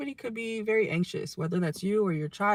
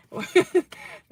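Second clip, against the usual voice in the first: embarrassed laugh; 11 semitones higher, 6 dB louder than usual. Real, not acted. Laughs at own memory.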